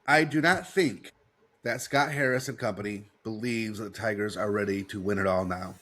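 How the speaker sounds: usual speaking voice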